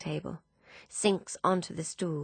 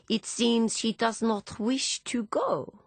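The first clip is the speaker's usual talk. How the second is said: put-on slow, higher French-accented drawl; heavy French accent; 6 semitones higher, slower than usual. A narrator's reading. Philippe smiling round his cigar, heavy French accent. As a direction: stall them, in a thick French accent. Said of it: "in a heavy French accent".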